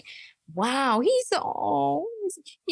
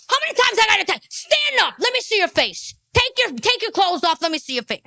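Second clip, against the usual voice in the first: high, loud mock orders easing off at the end; comic outburst of impatient commands; exasperated yelling; 9 semitones higher, 6 dB louder than usual. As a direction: yell it like a fed-up boss. Plays someone barking tattoo orders.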